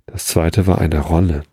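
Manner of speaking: usual speaking voice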